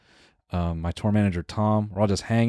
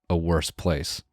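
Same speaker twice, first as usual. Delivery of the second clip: ordinary talk, nothing marked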